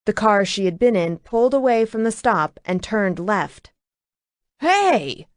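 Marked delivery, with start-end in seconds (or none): 4.6-5.3 high sharp call; a mild hail, pitched up; yelled. A narrator's reading. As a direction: truck driver calling out, higher. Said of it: "a man yelled".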